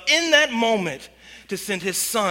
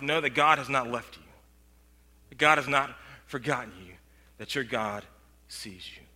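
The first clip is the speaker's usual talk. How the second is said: sinking lower and softer to a whisper-like close; fading hush; 8 semitones lower, 6 dB quieter than usual. Real, not acted. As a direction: let it fade away to almost nothing. Closing reassurance to congregation.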